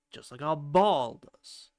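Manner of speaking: ordinary talk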